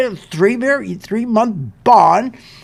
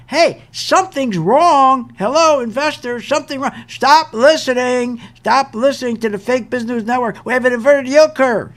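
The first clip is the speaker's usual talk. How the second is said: yelled warning; 5 semitones higher than usual. Acted. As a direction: yell it out like an alarm to investors. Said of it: "Inverted yield term yells out".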